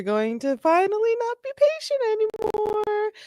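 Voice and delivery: long rising wail on the last word; stretched, squeaky high-pitched dread; mock alarm. Real, not acted. Commentator predicting the cops snap.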